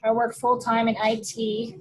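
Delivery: ordinary delivery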